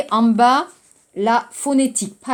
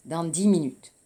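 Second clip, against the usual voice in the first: dans dix minutes said softly, each syllable linked; soft and smooth; 5 semitones lower, 5 dB quieter than usual. Shown as a demonstration. Teacher modelling the phrase smoothly for the class. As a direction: say it gently, all run together. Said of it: "부드럽게 말하세요".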